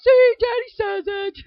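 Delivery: put-on high, loud little boy voice; squeaky proud kid quoting daddy; child's voice. Son proudly repeating his dad, high child voice.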